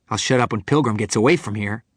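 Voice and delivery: loud, fast, heated voice; angry retort. Acted. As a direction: fire back in anger. Angry prisoner yelling back.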